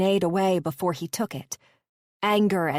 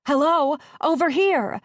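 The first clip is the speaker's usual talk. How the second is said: high, loud yell; shouting out; 8 semitones higher, 5 dB louder than usual. A narrator's reading. Gabby yelling at the raft to notice her, high loud shout. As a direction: scream to be seen.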